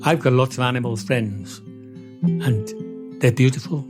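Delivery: ordinary talk, nothing marked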